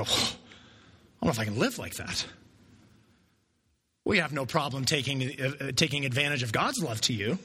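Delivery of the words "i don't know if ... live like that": lower, slow, lightly voiced doubt; doubting listener. A listener doubting the call to love, low and slow. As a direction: let it drop low and hesitant. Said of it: "you might go".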